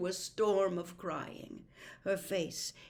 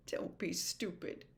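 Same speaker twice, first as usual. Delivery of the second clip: softer, breathy reassurance; soft kindness; 5 dB quieter than usual. A narrator's reading. Harry reassures Missus Weasley, softly. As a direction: comfort her gently. Harry consoling, trying to smile.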